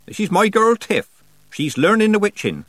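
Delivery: loud, high introduction. A narrator's reading. Nanny introduces the girl to old man, raising her voice. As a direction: speak up for the old man's benefit. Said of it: "said Nanny, raising her voice".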